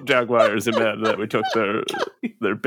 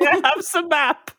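squeaky pleading; 3 dB louder than usual. Acted. Character begging for a map.

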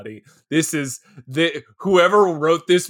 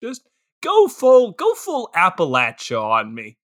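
mock coaxing; 5 semitones higher than usual. Client begs the attorney for a Southern accent, mock coaxing. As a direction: coax them playfully, pitch leaping up high.

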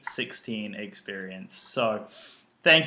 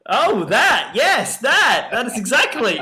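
excited; 11 semitones higher, 8 dB louder than usual. Pretend. Playful mock realisation about shirt.

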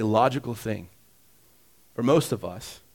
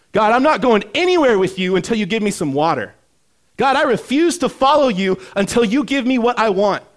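loud, high acted defiance; mock indignant ultimatum, voice raised; stubborn demand; 10 semitones higher, 12 dB louder than usual. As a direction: refuse God like a child in a tantrum. Acted stubborn refusal to God.

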